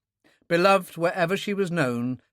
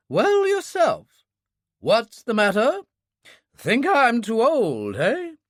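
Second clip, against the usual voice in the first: "Well" mimicked high, slow and louder; teasing old lady; 9 semitones higher, 6 dB louder, much slower than usual. A narrator's reading. Old lady mocks the doctor's doubt, high teasing echo. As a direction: throw his "Well" back at him, mocking. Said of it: "she interrupted immediately".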